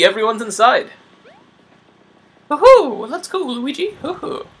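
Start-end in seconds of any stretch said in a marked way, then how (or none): 2.5-4.5 high excited cartoon cheer; bad Mario impression. Mario heading to the castle, high excited whoops. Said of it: "really bad Mario impression".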